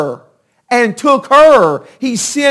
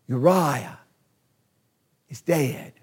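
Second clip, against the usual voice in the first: hushed grave news; 9 semitones lower, 12 dB quieter than usual. Acted. Messenger's grave news to David.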